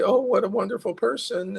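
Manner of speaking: high, delighted admiration; admiring listener. Acted. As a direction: gush sweetly about someone. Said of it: "the people say".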